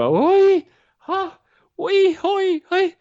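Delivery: high, loud squeals between gasps; imitated high-pitched panicked whimpers. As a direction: mimic a terrified tandem student's squeaky yelps. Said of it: "it's a high pitched".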